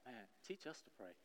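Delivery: acted-out request, barely voiced; hushed disciple. Disciple asking Jesus for prayer teaching, hushed.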